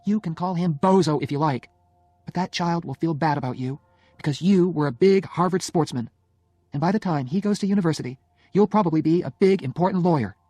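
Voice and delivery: animated, pointed argument with her husband; needling Jenny. Acted. Jenny keeps arguing, voiced by narrator.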